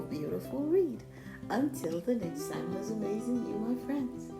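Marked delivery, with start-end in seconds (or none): none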